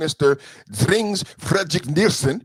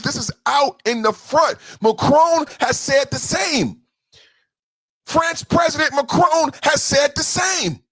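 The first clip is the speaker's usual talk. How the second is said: higher, building to a shout; getting angrier and louder about Macron; outraged; 11 semitones higher, 3 dB louder than usual. Real, not acted. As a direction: ramp up from indignant to yelling. Rising outrage at NATO.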